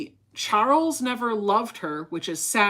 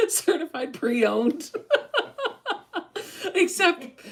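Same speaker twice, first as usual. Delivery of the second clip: laughing; 8 semitones higher, slower than usual. Real, not acted. Repeating joke while laughing.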